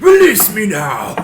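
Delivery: very loud, high, drawn-out roar; full-force in-character shout; imitated yell. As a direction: bellow the demand at the shaman. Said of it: "I'm gonna yell".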